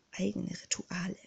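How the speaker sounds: barely audible, breathy hush; breathed whisper. Acted. Clouseau confiding secret rituals, barely audible. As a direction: let the words fall to a hush. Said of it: "hauchte er".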